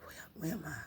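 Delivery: soft, whispered, stumbling question; dazed confusion. Retold or imitated. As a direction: retell it hushed, as if just waking. Retold waking up confused.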